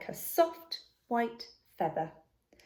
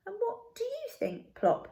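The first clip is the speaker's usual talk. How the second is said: gentle high question. A narrator's reading. Mother owl asking gently.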